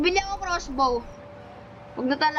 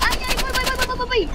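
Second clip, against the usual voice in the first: frantic calling out; 8 semitones higher than usual. Real, not acted. Panicked calling out to teammate.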